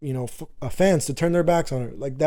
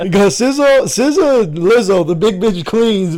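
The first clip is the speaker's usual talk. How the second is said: loud, high hype-man chant; hyped-up shout; 4 semitones higher, 7 dB louder than usual. Pretend. Hyping up [name] jokingly.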